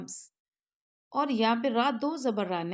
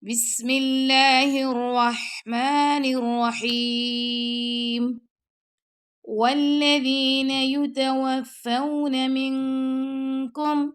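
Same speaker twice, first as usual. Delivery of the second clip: slow, louder melodic tajweed chant; slow chanted recitation; Quran recitation; 3 semitones higher, 7 dB louder, much slower than usual. Shown as a demonstration. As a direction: draw each word out in full recitation. Teacher recites Quran verse.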